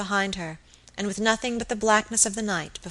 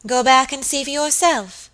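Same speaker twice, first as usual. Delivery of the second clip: firm, louder, raised voice; stern order; 5 semitones higher, 5 dB louder than usual. A narrator's reading. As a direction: send the maid off sternly. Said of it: "answered Lady Audley sternly".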